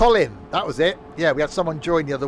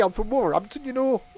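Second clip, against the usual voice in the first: put-on Wolverhampton accent; 9 semitones higher than usual. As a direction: try a Wolverhampton accent and miss. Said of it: "that wasn't Wolverhampton".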